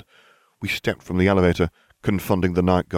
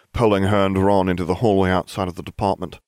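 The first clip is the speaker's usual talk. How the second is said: ordinary delivery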